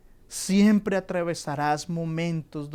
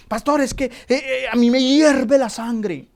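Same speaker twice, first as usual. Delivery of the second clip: high, stammering, swelling to louder on la sangre; mock hot-headed believer; 8 semitones higher, 10 dB louder than usual. Believer telling the pastor his blood boils, building louder. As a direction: act out a flustered excuse that builds to a loud outburst.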